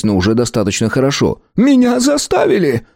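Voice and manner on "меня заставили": high, scared protest; frightened plea. A narrator's reading. Shopkeeper pleading with the hamsters, high and scared. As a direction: plead desperately, much higher.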